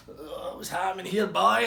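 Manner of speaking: high loud bellow, Scottish; loud stoned big man. Retold or imitated. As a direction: boom it out like a stoned giant.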